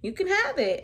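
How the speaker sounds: put-on high, bubbly child voice, eager to show off her gift; little girl's voice, bursting with excitement; excited little girl. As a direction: play the excited student handing over a drawing. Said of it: "And she's like".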